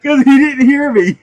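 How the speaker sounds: loud, high, through laughter; laughing glee. Real, not acted. Explaining why the guy was startled, laughing glee. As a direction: squeeze it out while laughing.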